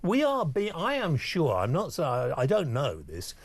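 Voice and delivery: ordinary talk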